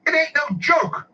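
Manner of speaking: very high, slow, indignant squawk; mock irate customer. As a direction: imitate a man losing it at an onlooker. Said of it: "it got real c-, crazy".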